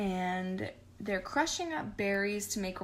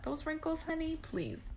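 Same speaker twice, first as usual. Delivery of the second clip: higher, softer mock-sassy put-down; sassy jab; 7 semitones higher, 5 dB quieter than usual. Real, not acted. Mocking Gothel's wrinkles with a higher, softer sassy put-down.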